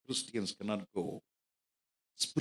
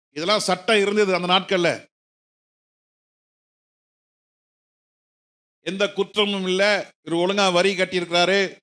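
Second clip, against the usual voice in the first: loud, raised-pitch sermon delivery; forceful preaching; 6 semitones higher, 12 dB louder than usual. Acted. Preacher tells the congregation about tax clearance for visas, forceful preaching.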